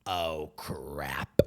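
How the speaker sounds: low, drawn-out impersonation of dying words; dying character impression. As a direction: drop way down for the doomed character's last words. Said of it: "literally his last dying words are".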